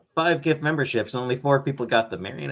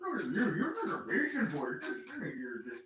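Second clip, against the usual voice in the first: pet talk to cat; 3 semitones higher, 10 dB quieter than usual. Real, not acted. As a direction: coo softly at a patient cat. Praising cat Sam before treats.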